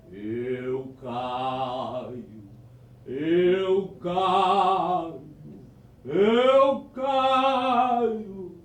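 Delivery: a ghost moaning from the ceiling; strange ghostly voice. Acted. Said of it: "uma voz estranha que dizia assim". (voz estranha de assombração)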